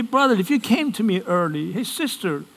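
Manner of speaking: high-pitched, hurried mock complaint; blaming complaint. Churchgoer blaming brother and sister for trouble, complaining. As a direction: act out someone pinning blame, pitched well up.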